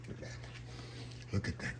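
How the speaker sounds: slow awed whisper; hushed whisper over the food. Real, not acted. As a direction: breathe it out in awe. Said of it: "admiring the hot dog".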